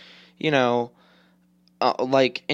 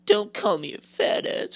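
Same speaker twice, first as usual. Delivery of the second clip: much higher, fake sobbing plea; mock crying; 9 semitones higher than usual. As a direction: parody a hurt kid whining through fake tears. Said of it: "I get really upset".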